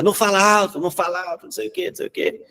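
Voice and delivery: high-pitched mock parent shushing; imitating a nagging parent, fading to a hiss; nagging parent telling the child to hush; scolding parent. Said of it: "aquele pai e mãe que fica".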